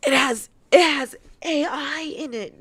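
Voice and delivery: high, loud aghast repeat, half crying; mock horror. Real, not acted. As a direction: gasp it out in comic dismay.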